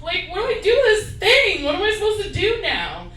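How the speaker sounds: hammed-up lovesick despair; begging friends for advice, high and anxious; mock desperate pleading. Pleading with friends after breakup.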